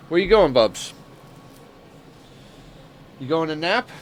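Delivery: light high coo to the pet. Real, not acted. Cooing at pet leaving.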